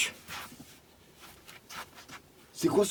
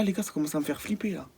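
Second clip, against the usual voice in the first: ordinary talk, nothing marked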